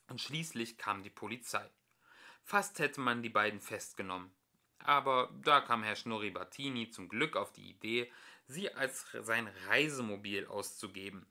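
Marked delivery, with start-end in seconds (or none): none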